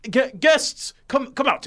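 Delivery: host calls shy halflings out of hiding; calling out. Acted. Host calls hidden guests out.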